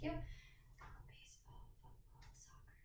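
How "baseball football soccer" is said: soft unvoiced whisper, word by word. Shown as a demonstration. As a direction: whisper each word from behind. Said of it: "I'm gonna whisper three words".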